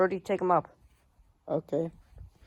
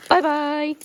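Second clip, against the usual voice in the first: squeaky bye; 9 semitones higher, 5 dB louder than usual. Acted. Train flying off.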